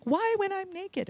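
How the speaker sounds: high, drawn-out mock whine; plaintive comic wail, kept soft; mock lament. Speaker joking about shower ideas, mock exasperated whine. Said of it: "I'm like".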